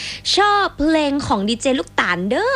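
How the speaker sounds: อ่านด้วยสำเนียงเหนือเบาๆ; northern Thai accent. Retold or imitated. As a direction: read the listener's message in a northern Thai accent. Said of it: "ต้องอ่านเป็นสำเนียงเหนือเนอะ".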